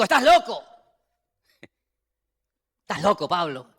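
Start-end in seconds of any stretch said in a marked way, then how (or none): 0.0-0.6 shouted high and loud, accusing. Retold or imitated. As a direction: yell it at him as Festus. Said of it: "a gran voz dijo".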